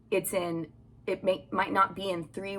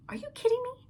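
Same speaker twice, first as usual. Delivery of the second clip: much higher, mock-amazed squeal; incredulous disbelief; faster than usual. Retelling his reaction to the contractor, mock amazed. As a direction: act out stunned disbelief at the deal. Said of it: "I was like".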